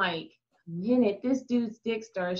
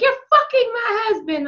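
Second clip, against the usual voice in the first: accusing wife; 9 semitones higher than usual. Retold or imitated. Angry wife confronting her, acted out.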